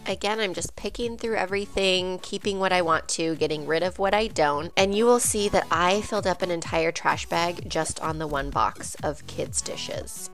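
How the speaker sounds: usual speaking voice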